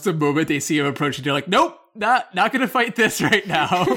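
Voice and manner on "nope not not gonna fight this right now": nope. Retold or imitated. Crew members bailing from the fight.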